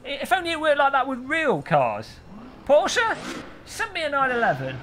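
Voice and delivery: high-pitched cheeky call for a freebie; pretend plea to carmakers. Streamer imagining asking Porsche for a free car, cheeky high-voiced plea. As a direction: pitch it up like asking pals a favour. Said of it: "They might oblige if I say".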